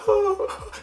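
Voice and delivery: high, loud fake sobbing; mock crying. Vlogger imitating Regina George's fake crying. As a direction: whimper theatrically like a spoilt girl. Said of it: "she's going".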